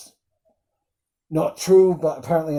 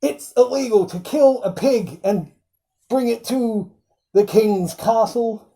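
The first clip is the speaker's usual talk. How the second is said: higher put-on pompous sheriff voice; imitating the sheriff; 6 semitones higher than usual. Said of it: "And he's like".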